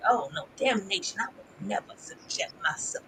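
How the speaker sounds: soft, near-whispered pious refusal; prim mock refusal. Recapper mimicking the mother's quiet refusal. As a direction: shudder it off quietly. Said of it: "But it's just like".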